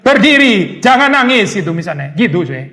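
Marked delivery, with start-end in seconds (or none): 0.0-1.5 higher, louder command to stop crying; harsh God. Retold or imitated. Preacher voicing a harsh God to a suffering believer, stern. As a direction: snap it like a merciless master. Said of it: "kemudian Tuhan".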